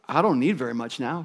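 soft, subdued reply; weary old boy. Acted. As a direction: quietly ask for little, worn out.

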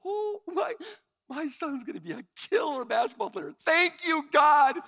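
high, loud burst of joy, shouting the thanks; a father overjoyed at his son's shots, shouting thanks to God; thrilled dad. Retold or imitated. Dad thrilled by son's three-pointers, high excited shout. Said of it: "I'm like".

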